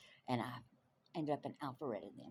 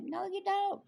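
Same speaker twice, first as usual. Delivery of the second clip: very high, drawn-out denial; playful disbelief; 4 dB louder, slower than usual. Retold or imitated. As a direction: squeal it in mock disbelief. Acted disbelieving reply to fan.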